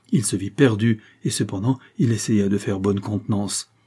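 plain reading voice

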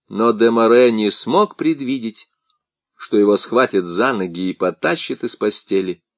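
ordinary delivery

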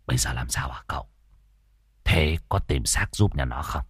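low, soft quick whisper; hushed question. Acted. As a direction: ask quietly so others won't hear.

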